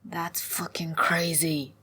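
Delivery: slow, hushed disbelief; mumbling. A narrator's reading. Sulon lies in the moss pondering, mumbling. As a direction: mumble it half under your breath. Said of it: "and mumbling".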